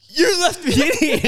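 high, loud, slow laughing protest. Retold or imitated. Protesting to the friend who locked him in, laughing loudly. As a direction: wail it through laughter.

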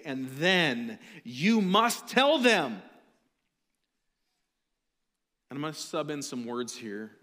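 loud, slow, ringing proclamation; full-force preaching. Acted. Pastor rewording scripture for his church, preaching full force. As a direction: proclaim deliverance from sin with power.

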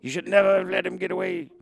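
a hint of urgent Loomis warning; lightly voiced warning doctor; Loomis impression. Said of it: "You know".

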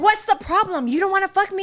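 high-pitched acted challenge; confronting partner. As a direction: question a refusing partner, mock-indignant. Acts out confronting her partner.

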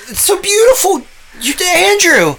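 awestruck. Acted. Awed at the vision.

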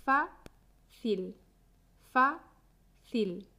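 "Fácil" split in two: FA, pause, cil; syllable by syllable. Shown as a demonstration. Teacher showing learners the two syllables, "fácil" syllable by syllable. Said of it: "Tiene dos sílabas".

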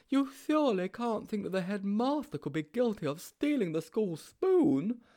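put-on high timid boy voice, shocked; Sponge. A narrator's reading. Sponge protests to Mildew about the headmaster, high and shocked. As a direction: gasp it out in disbelief.

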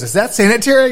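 high, loud mock-prissy question; worried buddy. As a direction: voice the squeamish friend. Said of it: "my buddy goes".

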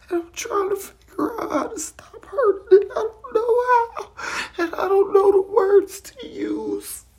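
tearful, voice breaking high; crying. Real, not acted. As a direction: let the tears break through the words. Tearful confession of hurting.

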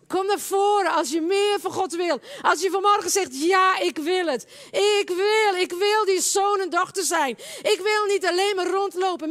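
high, loud, urgent altar call; impassioned preacher building to a long cry; full-force preaching. Real, not acted. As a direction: summon the congregation forward with fervour.

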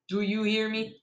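softly put, restrained threat; quiet warning. A narrator's reading. Child warning the bandit, quiet menacing question. First, low take to bandit.

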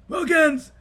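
high-pitched shouted plea; mock cry for help. Krieg at the bars calling Wilkins, mock yell. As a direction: imitate a neighbour hollering for rescue.

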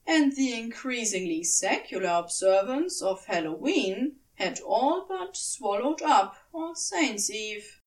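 ordinary delivery

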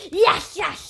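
loud happy yes; excited cheer. Real, not acted.

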